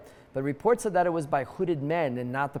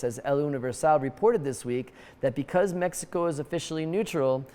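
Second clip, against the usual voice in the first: ordinary talk, nothing marked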